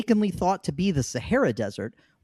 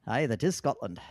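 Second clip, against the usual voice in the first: put-on a lower, soft stab at a Scottish accent; bad Scottish accent; 4 semitones lower, 6 dB quieter than usual. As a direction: try a Scottish accent, badly. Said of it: "do that accent again. That was terrible".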